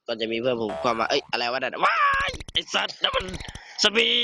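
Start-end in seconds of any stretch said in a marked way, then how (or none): 3.8-4.1 high panicked shout of zombie; a real startled cry; startled yell. Real, not acted. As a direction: yell it out in sudden fright. Friend spots a zombie.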